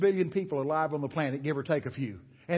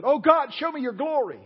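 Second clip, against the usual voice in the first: pleading cry; 10 semitones higher, 7 dB louder than usual. Retold or imitated. Moses pleading to see God.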